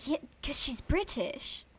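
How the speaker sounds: lilting put-on British accent. Shown as a demonstration. Explaining the Hermione likeness, British accent. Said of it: "You sound like Hermione".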